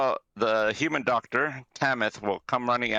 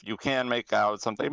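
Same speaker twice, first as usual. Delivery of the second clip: ordinary talk, nothing marked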